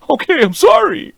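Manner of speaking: very high, slow squeaky apology; mock cowed squeal. Partner acts out answering bossy wife, mock cowed squeak. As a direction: squeak out a hammed-up submissive apology.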